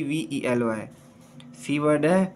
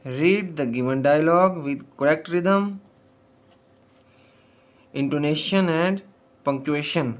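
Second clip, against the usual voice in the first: slow reading; much slower than usual. Shown as a demonstration. Teacher reads instruction slowly.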